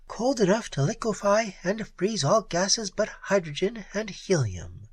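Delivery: a hint of a teasing jibe; jibing. A narrator's reading. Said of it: "she jibed".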